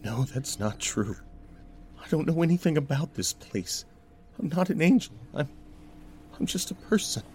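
high, hurried, faltering denial; high-pitched, flustered pleading; anxious protest. Acted. Narrator's character protests being an angel.

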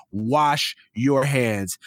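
"Wash your hands" slow, each word set apart; emphatic. Real, not acted. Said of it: "have to tell people and emphasize".

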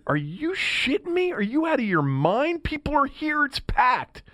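high, incredulous mock outrage; retold outrage. Host retelling how he reacted to Lefty's demand, high incredulous outrage. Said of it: "And I said".

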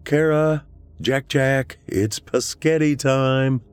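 high, singsong call through the house; yelled dinner call. A narrator's reading. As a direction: call the family in, pitched high, last words drawn out. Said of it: "and yelled".

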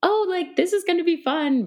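high-pitched, giddy anticipation. Retold or imitated. Mother recalling how her second labour felt, giddy.

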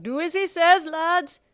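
high, loud conceding call; captain shouting a plea for mercy; Captain Nightlighter. A narrator's reading. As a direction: call out the surrender to both crews. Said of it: "Captain Nightlighter called out".

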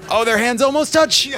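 usual speaking voice

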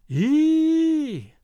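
long, high-pitched held "eee"; high "ee" cry. A narrator's reading. Eerie voice calling to the lying man, long high "eee". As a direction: wail a sustained high "eee". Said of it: "said a voice".

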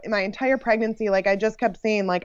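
normal talking voice

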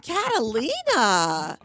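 drawn-out, swooping name; mock scandalized. Guest retelling her reply to her nephew's wife, mock scandalized. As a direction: stretch the name out in playful reproach.

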